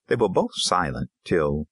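ordinary delivery, nothing marked

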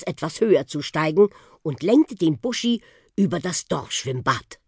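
usual speaking voice

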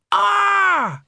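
high loud startled cry; sudden cry. A narrator's reading. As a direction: burst out with a cry. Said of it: "I said".

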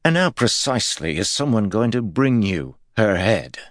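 high, doubting echo; skeptical. A narrator's reading. Guard mocking his lord's demand, skeptical. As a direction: throw the words back in disbelief. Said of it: "Ares' skeptical voice".

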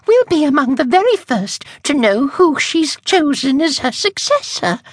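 high, breathless excitement; gushing young witch. Acted. Miss Scurrilous excited about the successor, very high gushing. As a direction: bubble over with the news.

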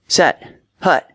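high-pitched quarterback cadence; mock screamed snap count. A narrator's reading. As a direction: call out the snap count sharply. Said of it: "he screamed".

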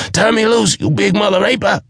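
dwarf screaming while being choked. A narrator's reading. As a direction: scream it in panic. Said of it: "he screamed".

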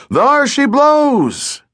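high, drawn-out call from the masthead; a sailor hailing the deck from aloft; distant lookout call. Acted. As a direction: sing it out across the deck.

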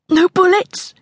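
high, rising, fearful question; anxious question. A narrator's reading. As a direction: ask it as a plea for safety. Girl bargains with her armed pursuers.

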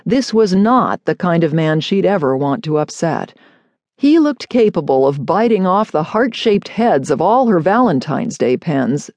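usual speaking voice

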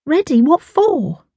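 put-on high-pitched, puzzled teen retort; frowning. A narrator's reading. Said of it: "Tash frowned".

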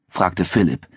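usual speaking voice

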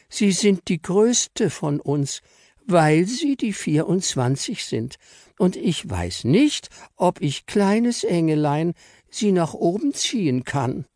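very high, slow, gentle voice; Fräulein Engel hesitantly offering to fly her up; timid little angel. Acted. Engel explains shyly.

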